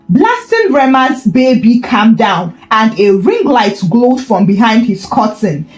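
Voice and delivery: usual speaking voice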